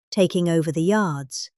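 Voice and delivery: usual speaking voice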